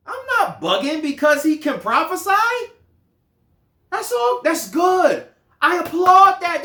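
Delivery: high, loud, exasperated shouting; incredulous Moses. Retold or imitated. Moses telling Joshua he welcomes all prophets, exasperated shout. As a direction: scoff at Joshua's jealousy. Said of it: "Moses is like".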